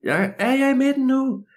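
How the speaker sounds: high, strained distant-style call; high-pitched holler without much volume. Acted. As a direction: shout the question across a maze. Boy shouts across the maze.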